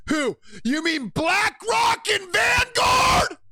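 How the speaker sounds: high, loud, slow incredulous shout; excited outburst. Real, not acted. Streamer reacting to guest, excited incredulous shout. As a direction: bellow the names in mock disbelief. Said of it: "that shit got me real excited".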